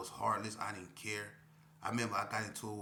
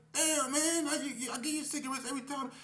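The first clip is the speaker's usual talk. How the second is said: high, loud, fast put-on K P voice; fed up with being asked; exasperated K P; 6 dB louder than usual. K P to the narrator over cigarettes, exasperated high voice.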